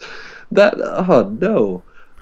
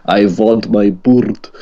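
put-on slow Russian-accented I vant my bird; slow, mumbled Russian accent, mock menace; Mickey Rourke impression; 4 dB louder, slower than usual. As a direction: drag it out like a heavy Russian thug. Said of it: "where he was like".

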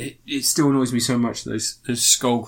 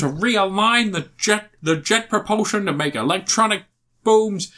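higher-pitched, badly faked American accent; dubious American accent; 7 semitones higher than usual. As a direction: send up an actor's American accent. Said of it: "Are you sure that's an American accent?".

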